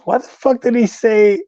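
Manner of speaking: high-pitched indignant disbelief; incredulous. Real, not acted. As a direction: blurt it out, voice shooting up. Reacts incredulously to listener's comment.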